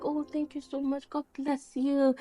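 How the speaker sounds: tired reply. Retold or imitated. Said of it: "she was so tired".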